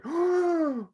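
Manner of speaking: loud, high theatrical gasp; mock gasp. Reacting to the secretary question, mock gasp. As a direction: gasp sharply in mock shock. Mock shock in retold scene.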